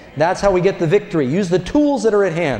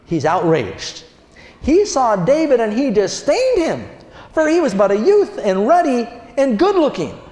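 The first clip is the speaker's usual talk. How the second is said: ordinary delivery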